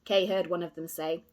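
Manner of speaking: plain reading voice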